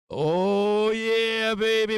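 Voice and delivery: high, loud victory cry; elated whoop. Real, not acted. Trader celebrating the trade, loud elated whoop. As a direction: let it burst out like a touchdown.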